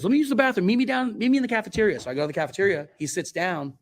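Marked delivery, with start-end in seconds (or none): 0.0-2.0 upbeat friend. Retold or imitated. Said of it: "he goes".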